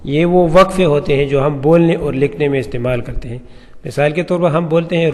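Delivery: usual speaking voice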